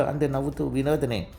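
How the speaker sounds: plain reading voice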